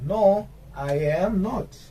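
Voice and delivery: very drawn-out, word-by-word reply; slow model answer. Shown as a demonstration. Teacher showing learners the negative answer, very slow. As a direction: stretch each word for learners.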